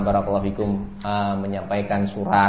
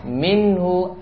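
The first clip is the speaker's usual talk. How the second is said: hadith recitation; 7 semitones higher, 5 dB louder, much slower than usual. Acted. Rasul's words recited in Arabic.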